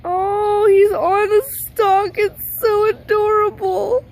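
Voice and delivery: high, loud, slow gushing over something cute; gushing coo. Real, not acted. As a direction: melt over the cute bird. Cooing at bird on stalk.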